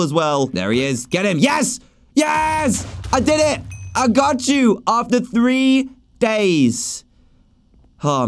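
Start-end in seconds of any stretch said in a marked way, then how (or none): 1.4-7.0 high victorious shouting; triumphant cheer. Real, not acted. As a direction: celebrate the hard-won win. Boss finally defeated.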